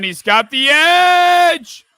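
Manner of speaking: excited call. Real, not acted. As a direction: hold the call as the runner breaks free. Calling a big run live.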